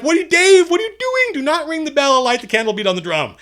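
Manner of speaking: high, frantic mock shouting; shrill-pitched loud exasperation, easing slightly toward the end; alarmed protest. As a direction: yell at a friend to stop, panicked and hammed-up. Acted alarm at Dave in retold game.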